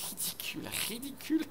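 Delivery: breathless unvoiced giggle; whispered giggle. Real, not acted. Teller giggling at his own story.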